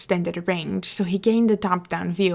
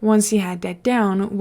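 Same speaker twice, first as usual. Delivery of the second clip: ordinary delivery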